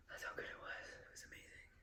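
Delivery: breathy secret whisper. Real, not acted. Recommending the steak to viewers, in a whisper. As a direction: whisper the praise close to the mic. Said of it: "I can't even hear you".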